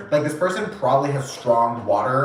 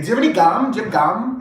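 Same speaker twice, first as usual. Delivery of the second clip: higher and louder, overly bright; hint hard that he needs gum; pointed hint; 6 semitones higher, 3 dB louder, faster than usual. Retold or imitated. Woman nudging her date to take gum, higher and louder pointed hint.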